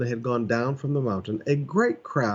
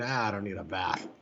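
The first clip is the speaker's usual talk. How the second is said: mock lazy guy; 4 semitones lower, 9 dB quieter than usual. Mocking guys' lazy excuse.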